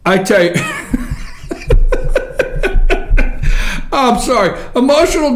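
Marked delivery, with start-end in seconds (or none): 0.6-3.9 long, high-pitched wheezing laugh; helpless laughing fit. Real, not acted. Host cracking up at emotional distress, helpless laughter. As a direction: crack up until the breath runs out.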